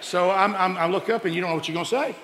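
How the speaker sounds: ordinary talk, nothing marked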